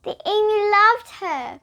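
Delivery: high storytelling. Acted. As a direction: sing it out to the kids. Story narration, marked line.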